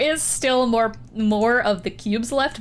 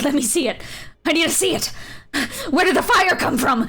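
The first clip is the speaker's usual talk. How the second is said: put-on mildly frantic young dragon, pitched up; frantic demand; 5 semitones higher than usual. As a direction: demand answers, voice climbing higher.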